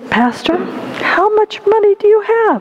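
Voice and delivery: lightly voiced boy, question pitched high; a hint of childish cunning; bargaining little boy. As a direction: ask slyly as a haggling boy. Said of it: "And the boy said".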